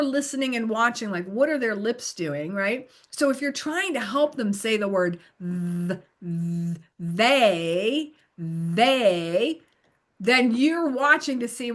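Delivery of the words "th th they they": "they" with a long buzzing TH, slow and rising; exaggerated TH. Shown as a demonstration. Tutor helping learners say they, exaggerated TH. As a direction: hold the TH at the front of "they", tongue on teeth. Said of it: "I'm exaggerating it".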